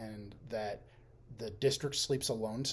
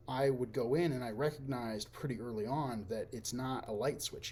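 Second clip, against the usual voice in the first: normal talking voice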